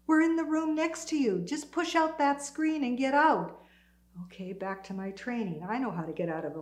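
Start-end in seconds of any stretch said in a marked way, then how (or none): 0.1-3.6 raised pitch, measured call. Retold or imitated. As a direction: call instructions to someone next door. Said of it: "I heard two voices calling".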